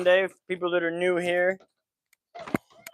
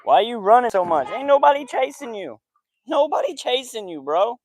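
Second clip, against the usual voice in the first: high teasing tone; 7 semitones higher, 7 dB louder than usual. Real, not acted. Teasing the hyper dog.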